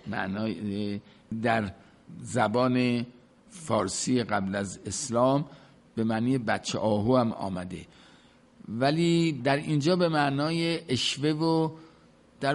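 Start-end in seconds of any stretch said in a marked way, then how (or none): none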